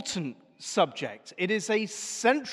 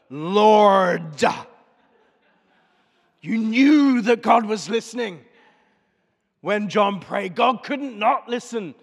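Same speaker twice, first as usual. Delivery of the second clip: big, loud South African prayer voice, much louder; booming South African accent; 8 dB louder than usual. Retold or imitated. Preacher imitating an old church friend's booming South African prayer. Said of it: "With his booming South African accent".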